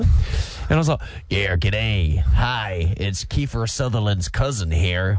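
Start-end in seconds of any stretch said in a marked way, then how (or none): 1.3-5.2 low, slow put-on American accent; fake American accent. Host phoning a motel, in a low fake American accent. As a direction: deepen into a bad American accent, pretending to be a cousin. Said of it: "phoned up with my American accent".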